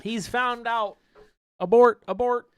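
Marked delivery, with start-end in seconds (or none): 1.6-2.5 high, loud robotic alarm. Pretend. Robot panics after being found.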